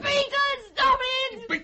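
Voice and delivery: high, drawn-out tearful plea; slow, panicked high cry; terrified cry. Acted. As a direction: wail at him to stop. Wife begs during strangling struggle.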